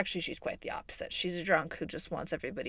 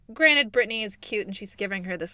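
ordinary delivery

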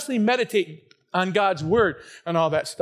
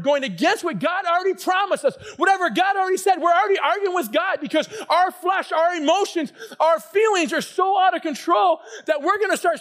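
high-pitched preacher's tirade, raised voice; real worked-up yelling; 8 semitones higher than usual. Real, not acted. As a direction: get carried away and yell at the congregation. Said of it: "yeah, I am yelling".

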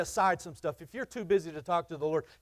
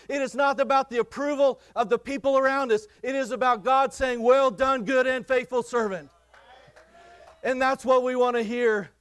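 fervent pulpit preaching, rising and pressing; preaching at full force; 4 semitones higher, 4 dB louder than usual. Acted. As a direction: drive it home to the congregation.

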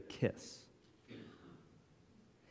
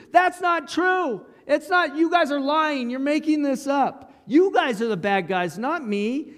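much higher, louder protest; defensive outrage; 12 semitones higher, 5 dB louder than usual. Pretend. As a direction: shout down the liars. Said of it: "He's unrightfully accused".

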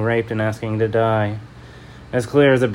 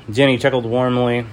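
ordinary delivery

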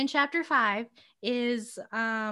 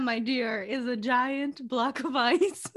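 ordinary talk, nothing marked

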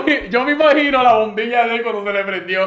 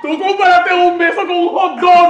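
high-pitched, incredulous laughing outburst; laughing outrage; 8 semitones higher than usual. Real, not acted. Friend teasing him over the hot dog kiss, laughing outrage. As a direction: cry it out through helpless laughter.

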